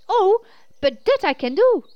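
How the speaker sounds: high, loud burst of excitement; excited yell. Acted. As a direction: shout with sudden joy. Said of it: "she yelled in excitement".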